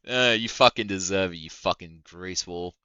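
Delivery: ordinary delivery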